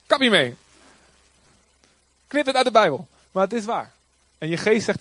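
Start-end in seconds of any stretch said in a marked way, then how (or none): 0.1-3.1 high, loud, dismissive scoffing; voice of the flesh. Acted. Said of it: "je vlees zegt". (spottend en luid, de stem van het vlees)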